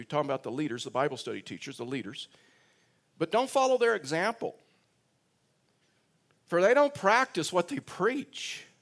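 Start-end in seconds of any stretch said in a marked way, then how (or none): 3.2-8.7 much higher, proclaiming the verse; emphatic preaching. Acted. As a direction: ring out the warning to the congregation. Preacher quoting Jesus emphatically.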